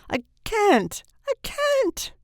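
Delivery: anguished cry leaping very high; moaning.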